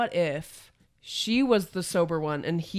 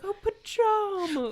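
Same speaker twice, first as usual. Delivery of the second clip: disappointed princess; 14 semitones higher, slower than usual. Retold or imitated. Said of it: "she's like".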